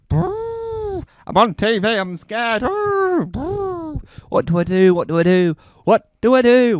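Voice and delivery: a frightened elephant in rising panic; high trembling creature, panic building; panicking elephant impression. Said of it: "This is my impression of an elephant".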